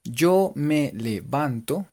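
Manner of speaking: "Yo me levanto" spaced out slowly; syllable by syllable. Shown as a demonstration. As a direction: count each syllable of "Yo me levanto". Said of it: "serían cinco sílabas".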